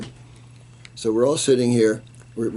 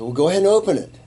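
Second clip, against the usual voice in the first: higher, louder gleeful push; gleeful urging; 9 semitones higher, 6 dB louder, faster than usual. Retold or imitated. Sergeant eagerly urging Moody to open the can, louder and higher. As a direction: egg him on with relish.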